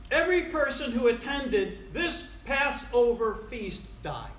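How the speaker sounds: normal talking voice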